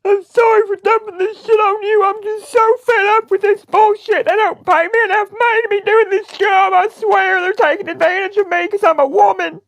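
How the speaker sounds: loud, high wail of put-on exasperation; mock-tearful outburst. Prank caller dumping her troubles on a stranger, wailing. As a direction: burst out crying, fed up.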